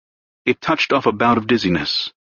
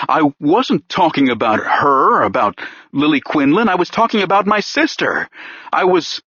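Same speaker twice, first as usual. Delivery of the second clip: frantic correction; 6 semitones higher than usual. Acted. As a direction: voice rising higher as he blurts it out. Pierce blurting out about sister.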